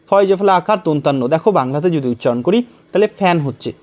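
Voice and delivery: normal talking voice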